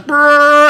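loud, high, drawn-out brrrr; ship's horn. Retold or imitated. Telling of a ship about to leave, loud imitated horn blast.

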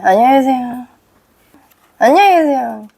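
listless demo greeting; wrong greeting tone. Shown as a demonstration. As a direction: greet the way you shouldn't, without projecting. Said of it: "어떤 말투로 인사를 해야 되냐".

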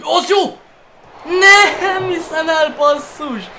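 high, loud disbelieving cry; frustrated shout. Real, not acted. Missed chance in football game.

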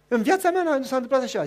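loud, high, bursting with joy; excited mimicked woman; overjoyed woman. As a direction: tell it like a miracle.

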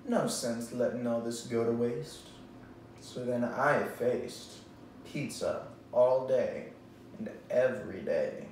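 ordinary delivery

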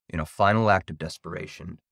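ordinary delivery, nothing marked

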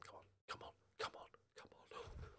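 whispered pleading chant; hushed anxious urging; tense whisper. Real, not acted. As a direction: breathe it under your breath, begging. Willing the final whistle.

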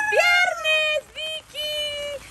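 high, drawn-out singsong cheer; celebratory announcement. Real, not acted. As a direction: stretch it out high, celebrating Friday. Celebrating Friday with Vicky.

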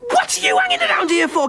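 exasperated shout. Real, not acted. Director scolds the actor.

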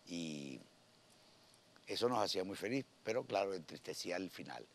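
hoarse, voice held in, no voice left; talking inward to spare a lost voice; speaking inward. Real, not acted. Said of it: "porque estoy hablando para adentro porque no tengo voz".

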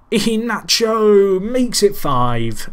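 loud, high, drawn-out commentator's cry; excited goal call. Real, not acted. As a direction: stretch the scorer's name in celebration. Commentator calls a live goal.

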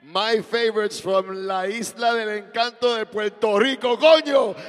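building to a stretched shout; hype announcer shout. Acted. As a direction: roar the act's name to the crowd. Emcee introducing the band.

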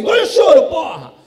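high loud snapped order; mock yell. Dad at Disney ordering the princess, loud mock bark. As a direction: bark it to stop her crying.

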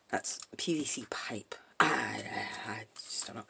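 soft whispered aside; whispering. Real, not acted. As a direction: drop to a whisper to yourself.

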